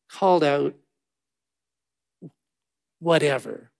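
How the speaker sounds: ordinary delivery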